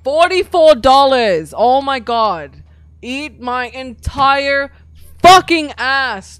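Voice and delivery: loud, slow, stretched outrage; loud slow rant. Real, not acted. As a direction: drag it out in disbelief. Angry at repair cost.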